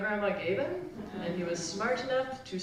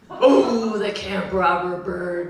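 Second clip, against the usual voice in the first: loud, drawn-out campfire scare; spooky uncle; 10 dB louder, slower than usual. Acted. Uncle terrifying kids at the family campout, spooky. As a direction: launch the scary tale with a big 'oh'. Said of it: "terrified me at our family campout".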